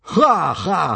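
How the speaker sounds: loud jeering soldier laugh; mocking laugh. A narrator's reading. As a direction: laugh at the little Viking. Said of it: "thought this was very funny".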